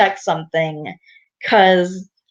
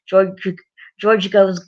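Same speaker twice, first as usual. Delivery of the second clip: ordinary delivery, nothing marked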